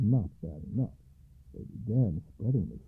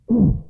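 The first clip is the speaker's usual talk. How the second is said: sharp loud ugh; loud disgusted grunt; 11 semitones higher, 11 dB louder than usual. A narrator's reading. Alba lands in the haystack with a loud disgusted grunt. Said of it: "exclaimed Alba".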